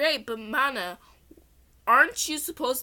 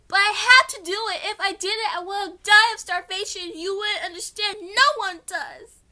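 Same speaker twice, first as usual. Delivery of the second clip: higher, louder defiant plea; defiant outburst; 10 semitones higher, 8 dB louder than usual. A narrator's reading. Girl defends her stealing to Priest Seto, defiant. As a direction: fling the words out in defiance.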